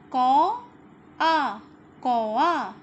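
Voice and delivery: कौ and आ split, then joined as कौआ, loud; syllable by syllable. Shown as a demonstration. Teacher sounding out कौआ in parts for kids, loudly. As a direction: break the word up and join it. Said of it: "पहला शब्द".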